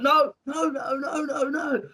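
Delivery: high, rapid-fire string of no's; high-pitched mock panic; panicked pleading. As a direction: yelp in alarm as your foot gets crushed. Acting out crushed foot panic.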